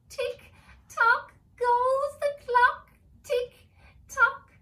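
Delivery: high, loud, slow screech; screeching elf. Acted. Tormented elf screeching the clock rhyme at Jamie. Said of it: "he screeched".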